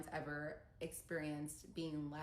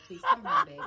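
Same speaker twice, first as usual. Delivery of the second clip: laughing plea; 16 dB louder than usual. Retold or imitated. Begging ex by text, laughing.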